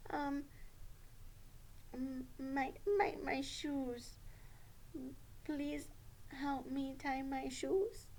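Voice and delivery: soft, hesitant puppet request; quiet, stumbling puppet pupil; careful puppet Sally. Pretend. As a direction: ask haltingly, feeling for the words. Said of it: "you need to have a strong, respectful voice".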